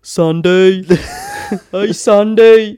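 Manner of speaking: high, loud, slow mock Brummie call; hammy Brummie calling Sandy; Brummy accent. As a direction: drag out Sandy in a silly Birmingham voice. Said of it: "Brummy Grease".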